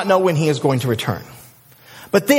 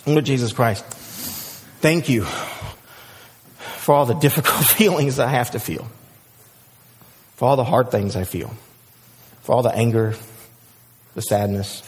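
low, soft prayer with long pauses; lowered, unhurried praying voice; 8 semitones lower, 4 dB quieter than usual. Real, not acted. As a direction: pray quietly and sincerely, phrase by phrase. Let me pray for us.

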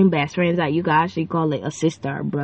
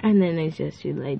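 ordinary talk, nothing marked

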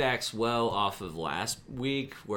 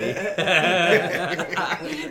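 loud laugh; 8 semitones higher, 7 dB louder than usual. Real, not acted. Laughing at his own joke.

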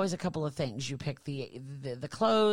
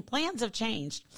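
usual speaking voice

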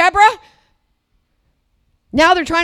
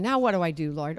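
quiet believer asking God; 11 semitones lower, 11 dB quieter, faster than usual. Retold or imitated. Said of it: "That's the question".

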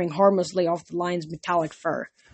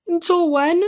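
panicked; 10 semitones higher, 3 dB louder than usual. Acted. Grover panicking.

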